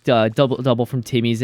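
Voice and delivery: usual speaking voice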